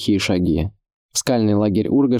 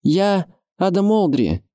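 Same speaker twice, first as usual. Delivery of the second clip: formal announcement in raised pitch; Адам; 10 semitones higher than usual. A narrator's reading. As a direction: introduce yourself as a young lord calling up. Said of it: "представился Адам".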